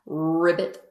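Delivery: low, loud rolled ribbit; imitated deep frog call; frog croak. Frog sound opens the frog page.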